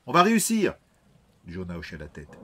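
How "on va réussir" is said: loud, high defiant yell; shouting. Acted. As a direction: shout it out to convince him. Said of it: "cria Ryan de toutes ses forces". (crié avec défi)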